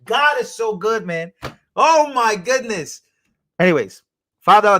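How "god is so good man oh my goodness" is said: high, slow, emphatic relief; real elation, stretched out; overjoyed gratitude. Real, not acted. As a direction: exclaim it with drawn-out joy. Overjoyed at donations returning.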